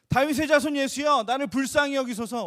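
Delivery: loud pleading cry to Jesus. Acted. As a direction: call out loudly, begging for mercy. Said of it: "갑자기 소리 지르면서". (간절하게 크게 외치는 목소리)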